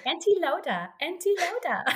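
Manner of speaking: put-on high little-kid calling; imitating a toddler. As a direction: call out sing-song like a small child. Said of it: "She keeps saying".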